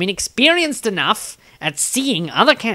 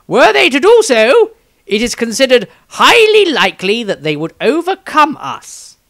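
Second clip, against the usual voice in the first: higher, louder pompous wizard voice, declaiming; Maestro's diary voice; 5 semitones higher, 5 dB louder than usual. Acted. Maestro reading his diary entry about the Skaven, in a raised, grand voice. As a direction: hold a raised, grand voice like a self-important wizard.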